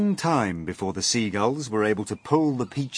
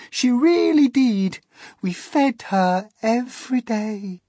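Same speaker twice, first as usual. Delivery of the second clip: high, louder storytelling spider voice; a high-pitched spider lady recounting a sad tale; Miss Spider's lament; 14 semitones higher, 6 dB louder, slower than usual. Acted. Miss Spider telling friends of grandmother, high plaintive voice.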